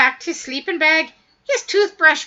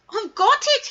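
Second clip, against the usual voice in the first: chuckling Weedle; 7 semitones higher than usual. Acted. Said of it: "he chuckled".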